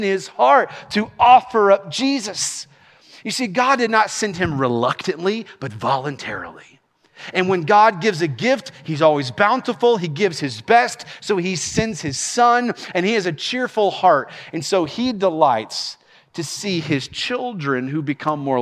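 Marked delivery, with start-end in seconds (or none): none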